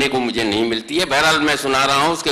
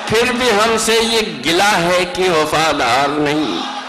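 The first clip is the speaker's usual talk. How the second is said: stately nazm recitation; 9 semitones higher, slower than usual. Acted. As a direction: build to a ringing peak. Poet recites climactic stanza. (پرجوش بلند آواز میں نظم خوانی)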